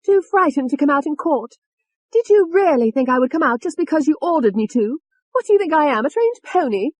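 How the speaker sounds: high, loud, scornful outburst; high-pitched furious taunting; angry. A narrator's reading. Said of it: "Tony said angrily".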